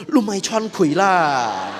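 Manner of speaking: a wife scolding her fidgeting fake-dead husband; mock scolding. Istri memukul suami yang bergerak. (omelan pura-pura, lebih tinggi dan keras)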